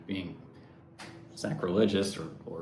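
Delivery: ordinary talk, nothing marked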